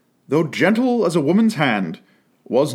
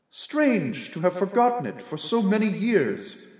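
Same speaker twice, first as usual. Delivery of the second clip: softer, higher, musing spirit; quiet ghostly spirit; 6 semitones higher, 6 dB quieter than usual. A narrator's reading. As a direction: observe it gently like a ghost. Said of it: "observed the ghost".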